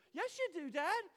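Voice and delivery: loud, high mock kid retort; emphatic contradiction. Daughter contradicts dad, retold.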